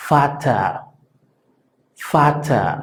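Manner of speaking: Vater: v suena f, fata; v alemana como f. Shown as a demonstration. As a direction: di Vater con f inicial. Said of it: "como si fuera una F". (Vater como fata)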